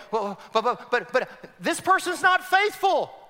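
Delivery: high, loud stammering protest; mock objection. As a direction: sputter the objection like a doubter. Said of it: "Now you may go".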